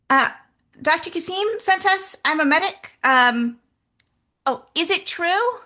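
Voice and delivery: high, loud, eager calling out; high nervous voice. Acted. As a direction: call out nervously to strangers. Nervous medic calls out.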